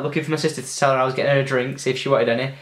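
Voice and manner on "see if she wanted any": ordinary talk, nothing marked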